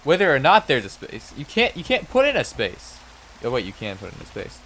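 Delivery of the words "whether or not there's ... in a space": frustrated rant. Real, not acted.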